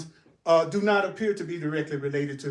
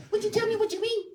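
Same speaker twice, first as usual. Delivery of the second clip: very high comic pupil voice; little invisible student; faster than usual. Acted. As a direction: squeak out a question as the imaginary student. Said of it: "That's my little invisible student".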